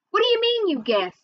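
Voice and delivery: high, sharp disbelief dropping at the end; indignant challenge. A narrator's reading. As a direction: pitch leaps high on the opening. Sister challenges brother's weak answer.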